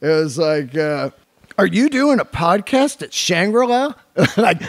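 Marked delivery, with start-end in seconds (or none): 1.6-3.9 high, disbelieving read of the text; incredulous text tone. Retold or imitated. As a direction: read it as if you can't believe it. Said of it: "I'm reading it in that tone".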